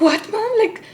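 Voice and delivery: high, loud disbelief; shocked. Retold or imitated. Asking mom in shock about her past, high and loud.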